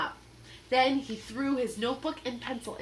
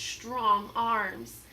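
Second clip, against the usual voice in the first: ordinary delivery, nothing marked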